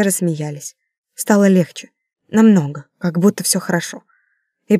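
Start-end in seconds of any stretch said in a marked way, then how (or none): none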